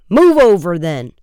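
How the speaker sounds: high, loud order falling off at the end; shouted. A narrator's reading. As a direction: bark it, then let it drop. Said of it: "another shouted".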